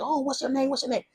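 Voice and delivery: high, quiet mock of a pushy guy. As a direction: imitate the guy pestering for her name. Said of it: "he was so aggressive".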